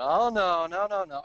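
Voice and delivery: loud, drawn-out mock protest; mock crowd yell; audience yelling out. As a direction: imitate a crowd calling out objections. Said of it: "audience starts yelling out".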